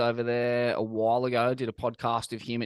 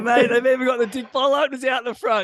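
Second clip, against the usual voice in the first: higher and louder, a hammed-up disbelieving yell; mock outraged shout; 10 semitones higher, 9 dB louder than usual. Telling a mate about the shop front, mock outraged shout. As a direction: act out blowing up at the bottle openers. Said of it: "I was like".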